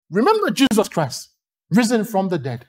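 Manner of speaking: ordinary talk, nothing marked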